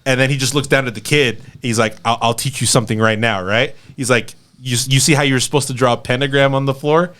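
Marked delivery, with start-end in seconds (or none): none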